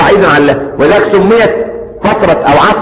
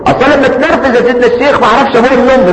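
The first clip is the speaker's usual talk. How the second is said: whiny excuse; 6 semitones higher, faster than usual. Retold or imitated. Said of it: "يقول لك".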